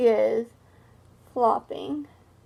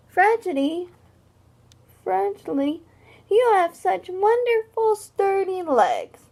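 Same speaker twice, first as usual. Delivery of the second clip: friendly compliment; 8 semitones higher, 4 dB louder than usual. Acted. Friend's compliment voiced in story.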